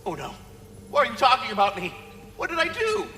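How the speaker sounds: high, fast, fretful pleading; anxious. Acted. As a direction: panic that you're blamed. Anxiety frets, defensive.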